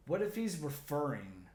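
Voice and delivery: ordinary delivery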